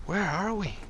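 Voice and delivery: a sleepy girl waking in a dark garage, lightly voiced; Kai's voice. Kai voiced by narrator.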